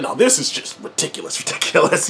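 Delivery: a stunned whisper about how ridiculous it is; hushed disbelief. Retold or imitated. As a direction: breathe it out in mock disbelief. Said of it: "It's like".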